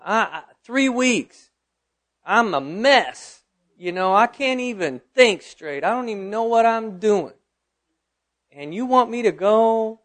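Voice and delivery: high-pitched, exasperated protest; indignant, incredulous complaint; retold anger. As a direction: protest to God in disbelief, stretching the words. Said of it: "I turned to the Lord in anger".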